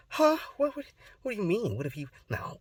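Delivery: high, stammering acted surprise; startled disbelief. Reacting to mom about grandpa seeing videos, mock startled. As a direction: jump up in pitch, then drop to dismissal.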